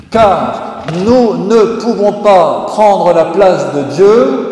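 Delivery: loud, slow, high preaching; forceful preaching. Acted. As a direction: thunder it out from the pulpit. (prédication tonnante)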